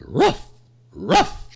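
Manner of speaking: two sharp high, loud barks; talking dog bark. Acted. Dog answers about sandpaper in a joke, barking. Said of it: "And the dog goes".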